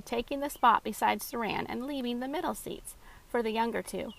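usual speaking voice

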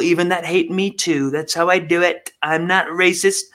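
lightly voiced blustering president; a hint of Trump bravado; mild Trump impression; mock Trump. Impersonating Trump to Putin.

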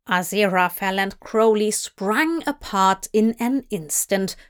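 ordinary delivery, nothing marked

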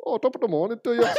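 high, rushed go at Irish; quick, squeaky Irish attempt; Irish accent. Shown as a demonstration. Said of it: "try and do one now".

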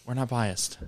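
quiet. Pretend. Repeated joke, said softly.

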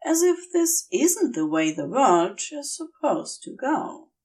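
plain reading voice

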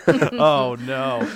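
usual speaking voice

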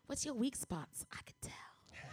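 soft whispered menace, slowing down; sneaky whisper. Retold or imitated. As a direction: whisper it like a schemer spotting weakness. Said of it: "who's always like".

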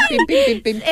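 very high squeaky pim sounds; remote clicking noise. Retold or imitated. Friend imitating Adriana flipping channels, high beeps. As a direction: chirp like a TV remote beeping.

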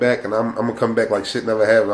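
normal talking voice

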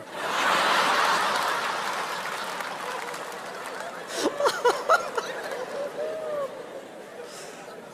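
high helpless laughing fit; long laughter. Real, not acted. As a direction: let the laugh run long. Audience and speaker crack up. (carcajada larga)

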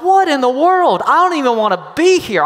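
high, loud, fast outburst; exasperated disbelief. Retold or imitated. Young man stuck at Disney on Ice, fed up and shocked. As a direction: blurt out frustrated shock. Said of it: "And I thought".

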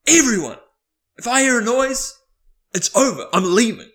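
loud, high imitated announcement; retold stern ultimatum in short, spaced phrases; dead-serious stern. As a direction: lay down the law to the whole room. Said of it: "he was dead serious".

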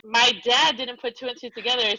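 usual speaking voice

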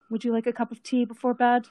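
lightly voiced kind mother, quick; a hint of a caring mom offering tea; mother's voice. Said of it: "and asks".

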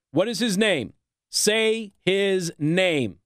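ordinary delivery

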